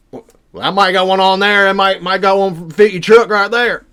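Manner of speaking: loud, gruff mountain man voice; rough backwoods guy. Retold or imitated. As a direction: play the big local who lives off-grid.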